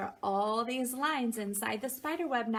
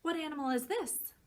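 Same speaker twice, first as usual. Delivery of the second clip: plain reading voice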